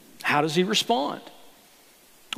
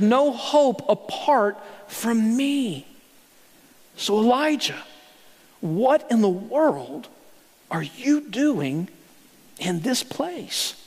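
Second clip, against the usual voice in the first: tender voice of God; slower than usual. Acted. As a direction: draw it out gently. Preacher voicing God to Elijah.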